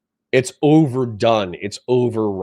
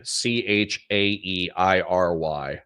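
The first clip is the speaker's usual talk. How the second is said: ordinary talk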